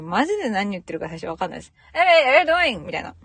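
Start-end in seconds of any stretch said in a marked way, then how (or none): 1.9-2.8 high, loud, run-together Aussie greeting; local Aussie greeting. Retold or imitated. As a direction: imitate an Aussie guy's slurred hello. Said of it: "その人の挨拶とか聞いてると".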